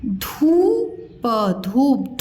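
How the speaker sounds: धूप split, with a long rising धू, then प; sounded out slowly. Shown as a demonstration. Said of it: "मैं अलग-अलग बताया करूँ". (धूप को अलग-अलग तोड़कर, धीरे)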